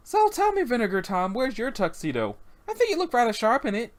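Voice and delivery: high, lilting character voice; chiding club hostess. Acted. Boss asking the bartender where his tuxedo is, high and chiding. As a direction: needle him lightly in a high voice.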